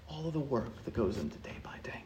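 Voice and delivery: fast, hushed, spent; whisper. Acted. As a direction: let the worry fade into a whisper. Anxious questions collapse to whisper.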